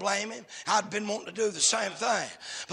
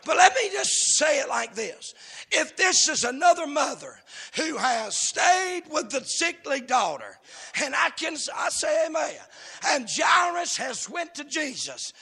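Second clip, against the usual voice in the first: high, driving pulpit delivery; impassioned revival cadence; fervent preaching; 5 semitones higher, 4 dB louder than usual. Real, not acted. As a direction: preach in urgent, punched phrases toward a climax. Preaches at full force.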